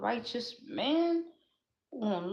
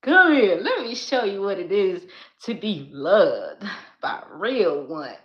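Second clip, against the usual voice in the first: imagined man calling her; 12 dB louder than usual. Retold or imitated. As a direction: boom out his welcome through laughter. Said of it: "he gonna be like".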